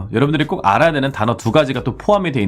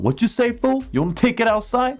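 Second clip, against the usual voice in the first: high taunting challenge; aggressive stranger; 12 semitones higher, much slower than usual. Shown as a demonstration. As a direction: step up and taunt. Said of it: "얘가 갑자기 다가와서". (시비 거는 사람의 높은 도발조)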